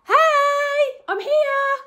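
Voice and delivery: high, loud shout across the valley. Acted. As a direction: yell to friends far away. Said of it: "shouted and waved".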